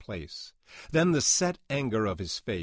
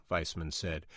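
ordinary delivery

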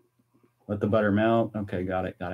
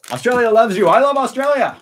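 very high, loud cheer; exuberant shout; 12 semitones higher, 9 dB louder than usual. Real, not acted. Streamer declaring love for Australian viewers, exuberant shout. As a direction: holler your love to the crowd.

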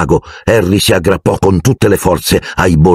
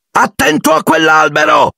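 high urgent warning shout; alarmed high cry. A narrator's reading. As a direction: warn him before the crash. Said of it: "gridò Harry".

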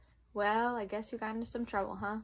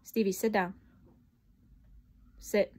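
loud, firm command to a dog; 7 dB louder than usual. Real, not acted. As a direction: order the dog to sit. Teacher orders dog to sit.

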